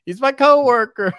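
high, loud call; shout. Retold or imitated. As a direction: yell it out high. Shouting to police, retold.

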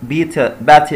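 ordinary talk, nothing marked

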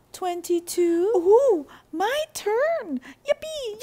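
usual speaking voice

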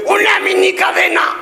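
put-on high, slow, strained kid voice; mock voice of a boy whose new braces pinch; odd tight-mouthed voice. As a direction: talk like braces squeeze your mouth. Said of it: "¿Por qué hablas así?".

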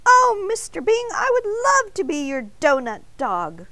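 a tiny voice full of happy thanks; joyful donut voice. A narrator's reading. Arnie the donut accepting Mister Bing's offer, high and joyful.

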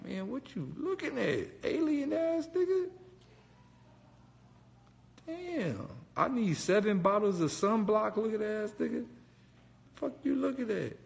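high squeaky mocking rant; squealing imitation of his own taunts; mock insult voice. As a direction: roast him in a high put-on voice. Insulting the cop in his head.

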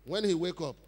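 ordinary delivery

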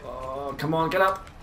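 ordinary talk, nothing marked

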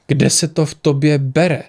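ordinary delivery

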